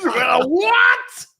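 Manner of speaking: high, loud, fake indignation; mock outraged shout. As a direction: yelp it in mock disbelief. Mock outrage after Hitler joke.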